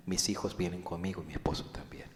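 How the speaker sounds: hushed, barely voiced admission; whispered confession. Believer confessing his family is at risk, whispered.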